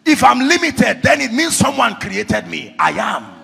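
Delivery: loud, emphatic pulpit teaching; full-force preaching. Real, not acted. Preacher explaining God's name to the congregation, full-force preaching. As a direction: drive each point home loudly.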